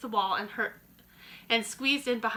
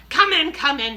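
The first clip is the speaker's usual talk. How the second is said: loud, impatient, a little higher; witch snapping; 5 semitones higher, 9 dB louder than usual. A narrator's reading. Grand High Witch snapping at the dithering witches. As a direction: snap them inside. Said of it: "snapped the Grand High Witch".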